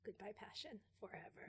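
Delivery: whispered, mock-tragic goodbye; mock farewell whisper. Bidding Ue's lost passion a mock-tragic whispered goodbye. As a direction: breathe it out like a tiny funeral.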